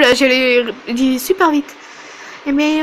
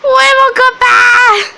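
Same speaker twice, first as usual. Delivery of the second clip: high, loud, slow wail; wailing child; 10 semitones higher, 6 dB louder, slower than usual. Acted. Little Mary wailing for her lost pet. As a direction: howl the question like a crying child. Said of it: "Désolée pour vos oreilles".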